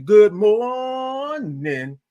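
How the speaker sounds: high, long-held MORNING greeting; drawn-out morning. Real, not acted. Host greeting viewers by name, morning drawn out. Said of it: "love all those O's and R's".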